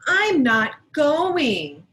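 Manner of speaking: loud, slow pouting no; stubborn child. Acted. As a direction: drag it out like a sulky kid under the covers. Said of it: "said Sarah".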